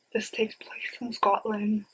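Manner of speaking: breathy secret whisper, confiding. Pretend. Vlogger whispering the setting to her viewers, conspiratorial whisper.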